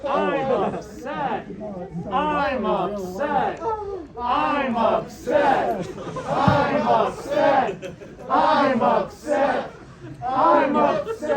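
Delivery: hammed-up group mourning; mock upset. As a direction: wail it like a crowd chant of grief. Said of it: "keep acting like you're upset".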